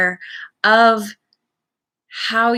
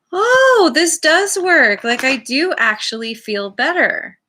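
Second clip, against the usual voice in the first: acted-out happy surprise, high and loud at first; pleasant realisation; 7 semitones higher, 4 dB louder than usual. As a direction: act out a delighted discovery, settling as it goes. Voicing pleasant realisation at results.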